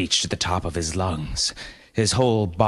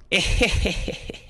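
put-on gloating child laugh; 13 semitones higher than usual. A narrator's reading. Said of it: "said the eight-year-old boy".

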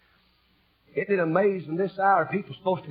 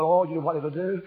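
ordinary talk, nothing marked

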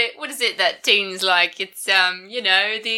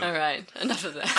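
quick, quiet cockney line with a laugh; a lightly done cockney rogue, quick and hushed; cockney accent; 5 semitones lower, 7 dB quieter, faster than usual. Acted. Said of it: "Oh, I'm just a cockney".

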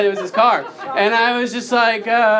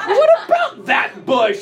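incredulous; 5 semitones higher than usual. Retold or imitated. Father questioning his screaming son.

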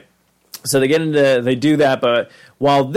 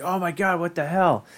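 shocked; 5 semitones higher, 5 dB quieter than usual. Retold or imitated. Rick noticing Summer.

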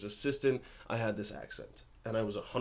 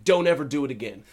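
loud, higher retold warning; mock scolding; 4 semitones higher, 8 dB louder than usual. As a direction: imitate an agent laying down the law. Agents' warning before congratulations.

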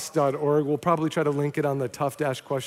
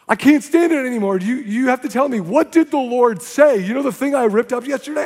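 higher and louder, urgent; desperate plea; 6 semitones higher, 6 dB louder than usual. Retold or imitated. The man begs the young evangelists, desperate. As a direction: beg them to tell you. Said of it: "that man ran up to these young Christians and he goes".

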